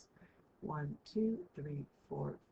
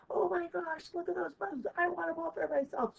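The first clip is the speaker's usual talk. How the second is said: much higher, excited cartoon dog; high, eager dog grabbing at a pile of bones; greedy dog voice; 12 semitones higher, 4 dB louder than usual. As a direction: play a dog who wants every bone for itself. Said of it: "And they're like".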